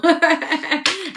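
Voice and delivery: amused cackle; high burst of laughter. Real, not acted. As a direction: cackle high and loud at the joke. Laughing at haters remark.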